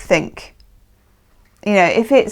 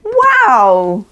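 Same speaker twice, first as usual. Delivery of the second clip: surprised wow; 3 semitones higher, 4 dB louder than usual. Real, not acted. Reader reacting to the client's cards, delighted surprise. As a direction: gasp it out, impressed by the spread.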